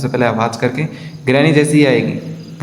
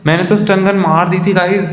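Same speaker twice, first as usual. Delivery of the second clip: shocked outburst; 6 semitones higher than usual. Real, not acted. Killed unexpectedly by Grandpa.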